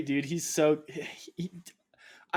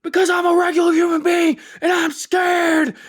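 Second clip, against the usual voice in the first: high, loud frantic wail; mock crying shout; 11 dB louder than usual. Imitating Alex Jones crying, high loud wail. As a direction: wail it like a grown man panicking. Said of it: "he was crying when he said that".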